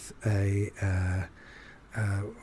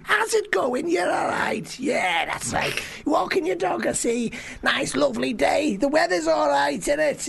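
put-on Scouse accent; 14 semitones higher than usual. [name] chatting to dog walkers, high Scouse accent. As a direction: greet strangers in pushy, high-pitched Scouse. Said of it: "going up to these strangers".

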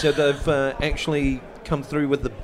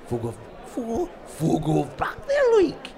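put-on slow, drawn-out Geordie accent; 10 semitones higher, slower than usual. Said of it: "But in a Geordie accent".

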